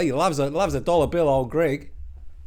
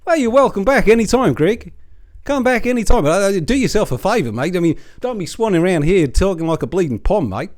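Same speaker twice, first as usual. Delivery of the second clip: calling out; 3 semitones higher, 7 dB louder than usual. Acted. Calling out to Greg on screen.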